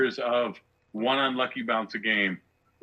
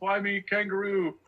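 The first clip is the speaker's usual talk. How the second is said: mocking fake Australian accent, pitched much higher; bad Australian accent; 9 semitones higher than usual. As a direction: ham up a bogus Aussie accent to tease a friend. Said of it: "and now he's like".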